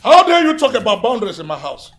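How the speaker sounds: outraged scolding. Acted. Boss berating his errand boy over his wife, outraged scolding. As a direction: thunder at him in outrage.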